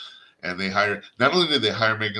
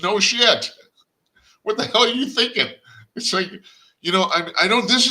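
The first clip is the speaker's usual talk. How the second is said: louder and much higher, scoffing in disbelief; exasperated outburst; 10 semitones higher, 6 dB louder than usual. Real, not acted. Host scoffing at companies ignoring their audience, exasperated shout. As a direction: blurt it out like you can't believe their stupidity. Host reacting to failed marketing.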